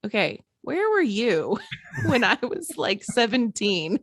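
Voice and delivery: half-laughing, a wry comeback. Retold or imitated.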